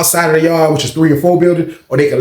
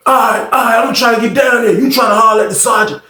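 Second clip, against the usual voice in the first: aggressive voice; 7 semitones higher than usual. Retold or imitated. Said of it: "aggressive slightly".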